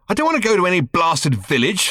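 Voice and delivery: sharp irritated snap; snapped. A narrator's reading. Ford snapping at Arthur about the village, irritated. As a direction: bite the words off in annoyance. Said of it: "snapped Ford".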